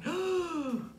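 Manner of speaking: high, soft gasp of suspense; dramatic storybook intake of breath. A narrator's reading. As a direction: gasp as if danger is lurking. Narrator gasps at lurking danger.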